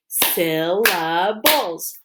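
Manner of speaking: "Syllables" slowly, split into three beats; clapped syllables. Shown as a demonstration. Teacher clapping with pupils, "Syllables" said beat by beat. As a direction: break "Syllables" into syl, la, bles. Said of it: "Let's clap it".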